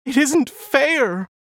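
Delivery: high, loud and slow, drawn out in grief; slow anguished plea. A narrator's reading. Alice protesting to her doctor, slow loud anguished plea. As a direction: stretch each word in despair. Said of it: "she pleaded".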